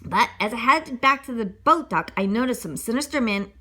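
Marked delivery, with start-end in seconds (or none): none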